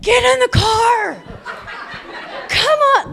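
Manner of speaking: put-on loud, high yell at the kids; drawn-out, exasperated shout; impatient parent yell. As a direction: holler it like a fed-up parent. Acts herself yelling at her kids.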